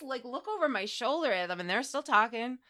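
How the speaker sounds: usual speaking voice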